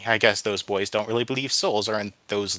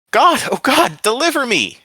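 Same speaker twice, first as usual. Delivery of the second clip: high, loud cry for rescue; desperate plea; 10 dB louder than usual. A narrator's reading. Boy on the pyre begging God, desperate cry. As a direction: beg at full voice to be saved. Burning captive begs God.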